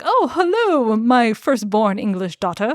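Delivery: imitated lord's effusive hello; fairy lord greeting. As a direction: play a fairy lord grandly welcoming his daughter. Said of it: "Lord Hallivale strides into this".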